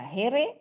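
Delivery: "here" read as two syllables, "he-re"; wrong pronunciation. Shown as a demonstration. Teacher listing misreadings for students, wrong pronunciation. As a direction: sound both e's in "here". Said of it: "bukan dibaca here".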